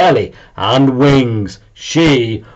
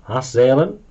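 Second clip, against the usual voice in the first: ordinary delivery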